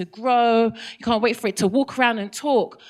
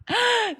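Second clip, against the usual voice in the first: mock gasp; 11 semitones higher than usual. Dramatic buildup before punchline.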